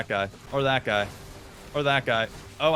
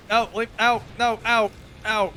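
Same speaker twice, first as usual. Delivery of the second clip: pained ow cries; 9 semitones higher, 3 dB louder than usual. Real, not acted. Taking damage from boss.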